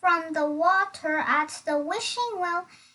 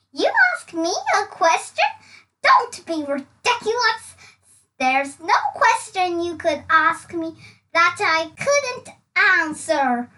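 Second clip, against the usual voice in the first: arrogant scoff; 6 dB louder than usual. Acted. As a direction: brush him off with contempt.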